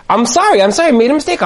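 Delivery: high-pitched, defensive apology; apologetic husband. Acted. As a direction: plead your mistake to an upset wife. Husband apologises to upset wife.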